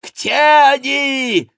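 very high, frenzied cry; wailing yell. A narrator's reading. Schomberg demands to find the Angevins, high frenzied yell. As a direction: howl the question. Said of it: "завопил Шомберг".

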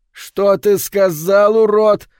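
high, loud, drawn-out angry challenge; angry hiss. A narrator's reading. Bobby to the albino who insulted him, angry hiss. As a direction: hiss it furiously, stretching the words. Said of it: "прошипел Бобби".

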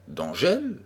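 D'Angèle asked with a rising puzzled tune; wondering D'Angèle?, pitch rising at the end; puzzled question. Acted. Friend questions the name.

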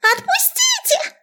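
tiny rat voice, very high and drawn out; squeaky rat shriek. A narrator's reading. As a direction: squeal slowly like a cartoon rat. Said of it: "принялась снова визжать".

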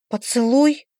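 exclaiming. A narrator's reading. Friend hears about the kiss, exclaiming in surprise. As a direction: echo it in surprise, lifting at the end. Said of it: "вскликнула Лекси".

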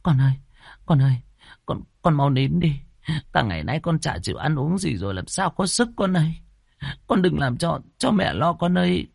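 high, anxious mother's coaxing; worried. A narrator's reading. Mother begs crying baby to hush, worried. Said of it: "Bà địa chủ lo âu".

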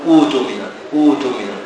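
أوتمن said with a long u and the second hamza turned to waw; أوتمن as utumin, no glottal stop after the first vowel; أوتمن بواو ممدودة بدل الهمزة الساكنة. Shown as a demonstration. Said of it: "فتقول أوتمن".

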